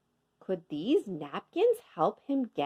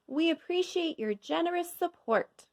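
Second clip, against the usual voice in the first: higher, formal thanks; mayor's speech; 9 semitones higher than usual. A narrator's reading. Mayor thanks the crowd, higher formal tone. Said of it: "the mayor said".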